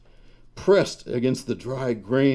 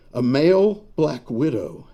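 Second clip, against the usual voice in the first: plain reading voice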